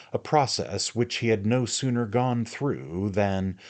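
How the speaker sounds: usual speaking voice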